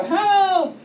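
high, loud call for help; acted high yell from the ledge; panicked cry. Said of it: "you start panicking".

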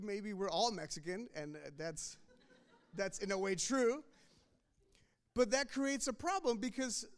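ordinary delivery

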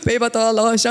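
mild retold tearful voice with a gasp; gasp, then lightly imitate a crying husband; imitated crying. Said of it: "he's just, like, crying".

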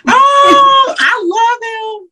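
high, slow gushing oh; squealing real delight; excited squeal. Real, not acted. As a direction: gush with delight at the name. Excited reaction to friend's name.

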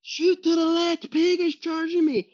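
high frantic yell to the left; retold screaming. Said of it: "I'm sitting there screaming at my dad".

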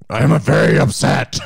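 loud, hammed-up German accent; angry-sounding fake German; mock German yelling. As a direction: bark it like an upset German. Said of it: "sounds like they're yelling at you".

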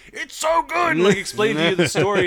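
high, loud gushing; excited. Retold or imitated. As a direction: burst out with joy. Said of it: "be like".

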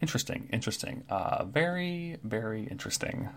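soft muttering to himself, trailing into a whisper; hushed, curious bird muttering; Kenku mumbling. Acted.

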